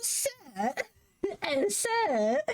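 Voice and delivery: squeaky-high imitation of a servile imp; silly high creature voice; little gremlin sidekick. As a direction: plead like a tiny gremlin underling. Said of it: "little gremlin sidekick".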